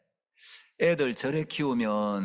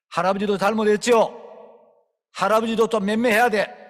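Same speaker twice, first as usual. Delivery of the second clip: loud, higher, playful rebuke; mock scolding; 7 semitones higher, 8 dB louder than usual. Preacher pretending to scold grandfather in front of the child, loud.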